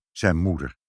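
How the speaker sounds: usual speaking voice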